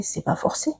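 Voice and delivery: breathy whisper, no voice at all; whispered aside. Real, not acted. Whispering to viewers about a suitor who won't take no. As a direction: drop to a bare whisper for the point.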